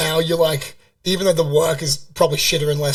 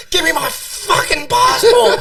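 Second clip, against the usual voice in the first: high, loud, drawn-out yell, re-enacted; retold screaming demand; 11 semitones higher, 6 dB louder, slower than usual. Traveller re-enacting screaming at airport worker for passport, screamed demand. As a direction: go full bogan, screaming out the demand slowly. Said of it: "screaming in this Filipino chick's face".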